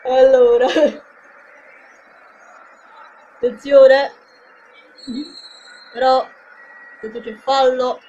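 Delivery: lively play-by-play call; live football commentary. Acted. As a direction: call the match for the fans.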